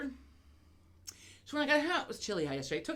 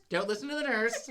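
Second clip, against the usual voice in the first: the same warning said again through a laugh; a giggling "don't listen to the nurse"; laughing repeat; 2 semitones higher than usual. Real, not acted. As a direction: let the laugh take over the repeat.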